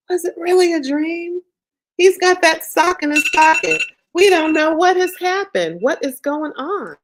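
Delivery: high, loud puzzled exclaiming; excited. Acted. Storyteller at the twist, high excited wonder. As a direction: wonder aloud, big and bright.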